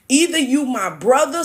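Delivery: usual speaking voice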